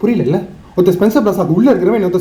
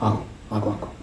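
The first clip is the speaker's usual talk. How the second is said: low bitter muttering; 10 semitones lower, 13 dB quieter than usual. Acted. Bitter agreement muttered to himself.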